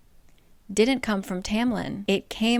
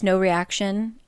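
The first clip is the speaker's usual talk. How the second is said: normal talking voice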